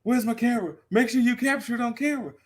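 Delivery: high-pitched, rushed acted plea; mock urgent demand. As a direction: imitate frantic orders, speeding up. Acts out rider demanding his camera.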